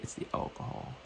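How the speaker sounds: soft whispered realization; whispering. Retold or imitated. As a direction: whisper it as it dawns. Quiet realization retold.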